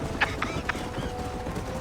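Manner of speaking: short wheezy laugh at his own joke; an old guide cackling at his own quip; cackling chuckle. Acted. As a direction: chuckle low at your own wisecrack.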